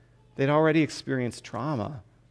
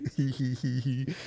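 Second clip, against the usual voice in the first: giggle; 7 dB quieter than usual. Real, not acted. Preacher laughs after Jesus quote.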